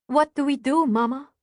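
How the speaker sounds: put-on a scared little girl looking up at her mother; frightened child. A narrator's reading. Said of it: "Anya says".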